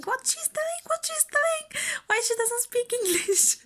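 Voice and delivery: high-pitched, frantic mimicked questions; mock a flustered midwife in a very high voice; alarmed nurses. Nurses and midwives quizzing the translating husband, mock high-pitched alarm. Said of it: "nurses and the midwives were asking".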